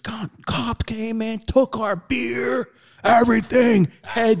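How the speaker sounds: very high, slow whine; high, slow mock whine of a muddy partier; muddy guy whining. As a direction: whine the loss out slowly.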